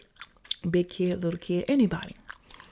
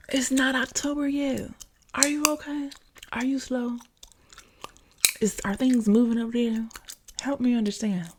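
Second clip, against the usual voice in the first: high-pitched, disbelieving jabs at the son; exasperated mom roasting her son; incredulous mock concern; 6 semitones higher than usual. Real, not acted. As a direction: ask it like he's lost his mind. Mocking son who tossed mayo.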